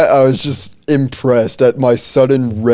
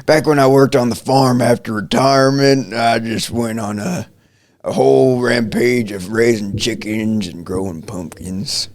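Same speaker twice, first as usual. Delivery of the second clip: put-on southern accent. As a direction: reminisce like a retired southern farmer. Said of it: "like, a southern accent".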